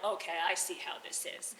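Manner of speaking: low, soft, knowing snake voice; low grumbling snake. Acted. Snake grumbles at the old lady, low and soft. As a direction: murmur it low like a sly serpent. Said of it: "And the snake is like".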